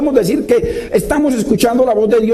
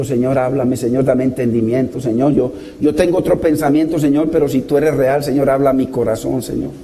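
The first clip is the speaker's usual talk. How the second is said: humble prayer; 8 semitones lower than usual. Retold or imitated. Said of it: "Qué lindo decirle, "Señor".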